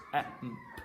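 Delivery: ordinary delivery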